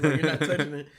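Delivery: loud, insistent mock order; retold scolding. Retelling a spat over the car music, loud retold scolding. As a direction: lay down the law loudly, retelling it. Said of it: "'cause she's like".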